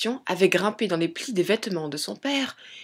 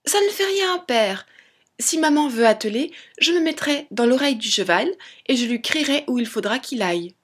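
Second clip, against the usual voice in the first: tiny Tom Thumb, confident; 4 semitones higher than usual. Acted. Son reassures his doubting father, high childlike voice. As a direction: pipe up like a plucky little boy.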